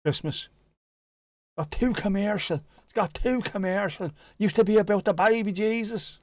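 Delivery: high-pitched Dublin-accent comic impression; hammed-up, high Irish complaint; Tommy Tiernan. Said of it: "Tommy Tiernan".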